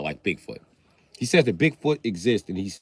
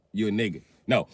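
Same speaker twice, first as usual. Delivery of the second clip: usual speaking voice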